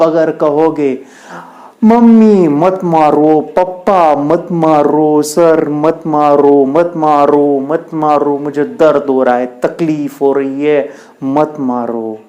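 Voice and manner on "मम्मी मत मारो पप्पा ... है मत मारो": crying child imitation. Child begs mummy, papa and sir not to hit, mock crying. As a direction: beg slowly like a child being beaten, fading at the end. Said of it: "ऐसे ही रोते रहोगे".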